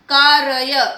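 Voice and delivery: कार्य said as "kaa-ra-ya"; wrong split pronunciation. Shown as a demonstration. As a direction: give the r in कार्य a full vowel, no trill. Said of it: "अशुद्ध शब्द है बच्चों".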